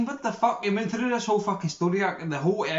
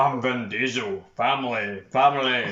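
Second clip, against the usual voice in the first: much lower, slow Vin Diesel voice; slow low rumble on "Family"; Vin Diesel impression; 7 semitones lower than usual. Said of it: "your Vin Diesel impression was fucking hilarious".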